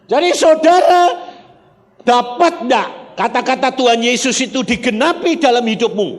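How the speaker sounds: high, loud pulpit voice; fervent sermon delivery; full-force preaching. Real, not acted. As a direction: preach it out to the whole congregation. Preaching Jesus' promise at full force.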